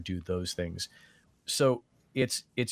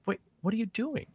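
high, fast baffled protest; a fan confused at the missing accent; 13 semitones higher, faster than usual. Retold or imitated. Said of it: "people are gonna be like".

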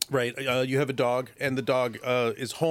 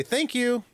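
"Thank you." sung out much higher; bright upward inflection; 10 semitones higher than usual. Shown as a demonstration. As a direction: lift "Thank you." way up, cheerful and light. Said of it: "saying it with a nice upward inflection".

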